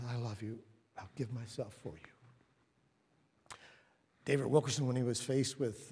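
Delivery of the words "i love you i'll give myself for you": soft, gentle promise of love; tender declaration. Acted. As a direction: speak it softly, as a devoted reassurance. Jesus declaring love, acted softly.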